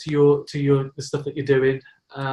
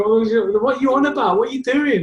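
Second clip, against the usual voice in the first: higher-pitched puzzled questioning; puzzled wife; 9 semitones higher than usual. Retold or imitated. Wife calling to her laughing husband, mock puzzled. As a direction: lightly mimic a baffled wife, voice raised.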